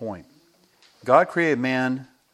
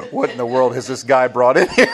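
shocked; 2 semitones higher, 9 dB louder than usual. Retold or imitated. Kids shocked at the guest.

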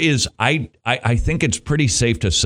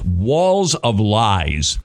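slow emphatic; 4 semitones lower, much slower than usual. Acted. Preaching emphasis.

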